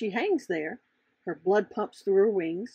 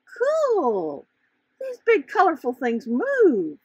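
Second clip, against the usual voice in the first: higher, louder thrilled exclamation; bursting with childlike delight; excited butterfly; 7 semitones higher, 5 dB louder, slower than usual. Acted. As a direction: cheer at the discovery like a kid.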